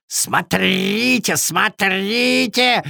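high, slow raven call with rolled r's; raven yelling. A narrator's reading. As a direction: cry out high, stretching each word. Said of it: "закричал он во всё горло".

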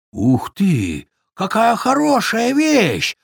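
high, drawn-out beaver voice; exclaiming beaver. A narrator's reading. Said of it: "воскликнул бобёр".

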